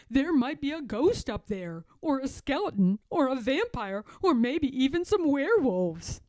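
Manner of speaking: a lightly voiced fearful friend; scared George.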